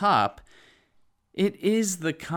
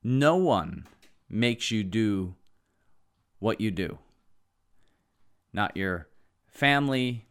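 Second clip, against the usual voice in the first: clear and assured. Real, not acted. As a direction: state it firmly, phrase by measured phrase. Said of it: "I want to state this clearly and assuredly".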